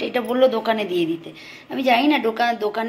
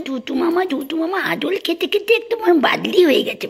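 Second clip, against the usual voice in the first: high rising doting coo; 7 semitones higher, 4 dB louder than usual. Real, not acted. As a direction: gush over the chubby pet. Cooing at pet.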